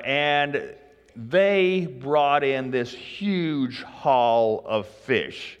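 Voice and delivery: ordinary talk, nothing marked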